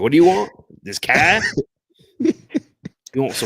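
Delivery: loud, high-pitched mock Australian accent. Dad making fun of his son, mock Australian accent. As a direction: tease with an exaggerated Australian accent. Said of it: "I'd be making fun of him so".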